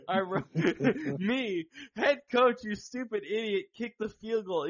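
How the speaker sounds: can't stop laughing reading it out; laughing while reading. Real, not acted. Reading his own chat message, laughing.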